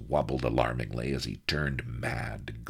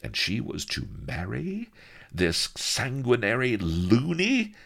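ordinary delivery, nothing marked

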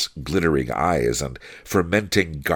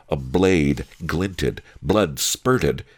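ordinary delivery, nothing marked

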